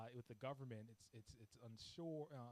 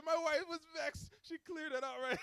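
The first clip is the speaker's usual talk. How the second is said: a much higher, louder attempt at Jamaican speech; Jamaican accent; 15 dB louder than usual. Jamaican client explaining his wife settled it, attempted Jamaican accent. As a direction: give his wife's fix in a try at Jamaican lilt. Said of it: "I can't do the accent".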